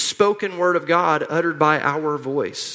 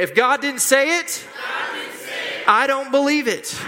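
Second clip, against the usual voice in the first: higher, emphatic call-and-response line; like you mean it; 6 semitones higher than usual. Shown as a demonstration. Preacher leading church to say it again, like they mean it. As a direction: declare it with conviction for the crowd to echo. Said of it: "Say it again like you mean it".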